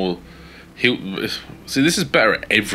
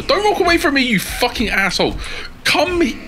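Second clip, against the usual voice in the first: angry yell. Real, not acted. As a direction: scream at the companion who keeps running off. Yelling at fleeing companion Roscoe.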